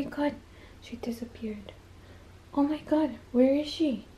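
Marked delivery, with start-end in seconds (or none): 0.0-1.7 mock surprised whisper; hushed playful whisper. Pretend search, whispered.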